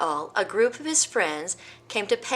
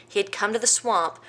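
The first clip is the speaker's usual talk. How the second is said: ordinary delivery, nothing marked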